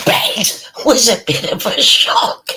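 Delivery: building to a high, loud cackling exclamation; amused outburst. Real, not acted. Imagining Frank finding her on the bed, amused outburst. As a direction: burst upward, laughing at the shock.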